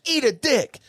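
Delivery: high, snappy retort; a fan firing back at his heckler, high and sharp; mock comeback. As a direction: toss the insult back hard. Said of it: "and he goes".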